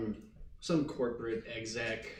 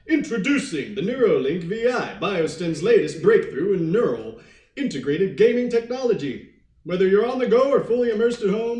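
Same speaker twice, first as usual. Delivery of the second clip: booming, high-pitched, swooping commercial voice; corporate ad announcer; 8 semitones higher, 12 dB louder than usual. Acted. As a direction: oversell it like a loud ad, trailing into blahs. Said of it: "running a corporate ad for Biostem".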